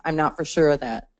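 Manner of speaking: ordinary talk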